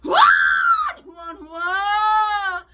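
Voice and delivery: drawn-out wailing shout of "후원"; mock begging shout. Mocking greedy pastors begging for donations, drawn-out wailing shout. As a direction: howl the word like a beggar crying out. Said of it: "눈깔만 뜨면".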